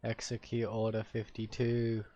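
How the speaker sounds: slow, drawn-out "Execute Order fifty-two"; mock solemn command. Player giving a mock order, slow and deliberate.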